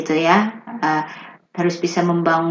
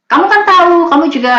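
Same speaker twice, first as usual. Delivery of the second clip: laughing; 11 semitones higher, 4 dB louder, faster than usual. Real, not acted. Laughs, teasing the host.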